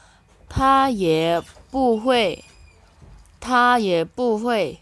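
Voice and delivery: very slow, clear Mandarin, every syllable spaced; slow model reading. Shown as a demonstration. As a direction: read it slowly for learners to copy. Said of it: "teacher models dialogue line".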